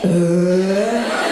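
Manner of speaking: long imitated belch; loud-ish drawn-out fake belch; burp. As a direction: follow up with a mock burp. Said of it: "coffee makes me burp".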